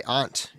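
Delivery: "aunt" said as "ahnt"; aunt with broad A. Shown as a demonstration. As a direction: give "aunt" an open ah vowel. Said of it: "they say aunt in Jersey".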